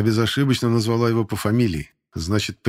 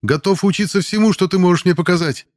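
loud, much higher brash call; cheeky excited shout; 9 semitones higher, 5 dB louder than usual. Acted. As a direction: shout it out eagerly at her. Cadet jumps up, shouting.

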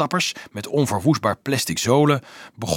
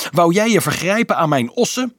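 shrill indignant challenge; high, outraged question; Nicolaas; 8 semitones higher, 4 dB louder than usual. A narrator's reading. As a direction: protest as if robbed. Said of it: "gilde Nicolaas schel".

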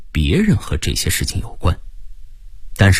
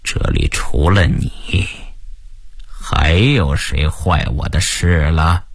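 slow, low, menacing questioning; cold interrogation; 5 semitones lower, slower than usual. A narrator's reading. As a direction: draw the question out coldly. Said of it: "峰哥冷冷地逼问我". (冷冷地逼问)

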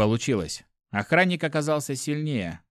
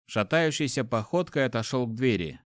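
ordinary delivery, nothing marked

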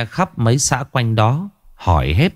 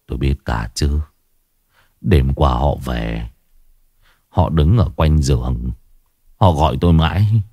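put-on low, hushed old man's voice; an old man haunted by the dead, speaking low; eerie whisper of a dying old man; 7 semitones lower than usual.

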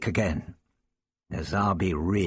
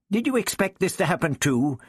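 bitter; 8 semitones higher than usual. A narrator's reading. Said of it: "Ian asked bitterly".

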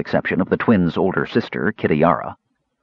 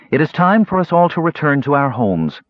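higher, raised voice to a crowd; Shabbos addressing everyone; 7 semitones higher than usual. Acted. Counselor to everyone in the park, raised and higher. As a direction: speak up to the whole park.

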